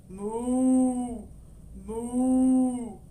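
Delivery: loud drawn-out moo; cow moo. Acted. Cow heard as mooing.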